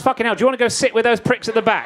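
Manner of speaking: normal talking voice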